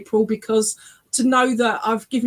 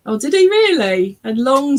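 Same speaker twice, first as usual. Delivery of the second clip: higher, louder surprised reaction; real surprise; 5 semitones higher, 7 dB louder than usual. Real, not acted. Medium hearing about his hair, surprised. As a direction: react with a raised, loud burst of surprise.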